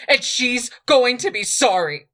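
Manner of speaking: angry vow. A narrator's reading. Queen vows revenge as she leaves, angrily. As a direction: promise revenge hotly. Edis leaving, vowing revenge.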